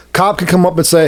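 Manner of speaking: usual speaking voice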